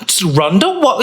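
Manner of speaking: hammed-up viral pitch with a laugh; mock influencer.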